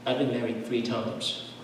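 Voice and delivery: soft, slow, hushed; quiet as if from a sickbed; quoting a dying woman. Retold or imitated. Dying church sister confessing to her pastor, hushed. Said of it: "I'm quoting her".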